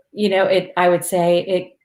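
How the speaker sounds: ordinary talk, nothing marked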